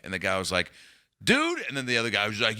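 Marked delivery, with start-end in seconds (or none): 1.2-1.6 exasperated guard. Retold or imitated. Guard reacting in disbelief.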